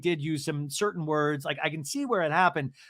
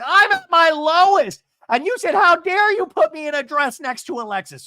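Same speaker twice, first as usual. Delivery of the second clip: high, loud dramatic yelling; mock meltdown; 12 semitones higher, 10 dB louder than usual. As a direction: yell it as a hammed-up breakdown. Acting out Alexis's meltdown.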